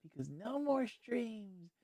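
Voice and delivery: slow, high whiny lament; high slow mock whining; mock sulking. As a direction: whine it out, stretched. Sulking over lost streams.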